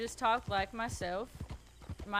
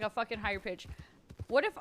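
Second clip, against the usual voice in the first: ordinary delivery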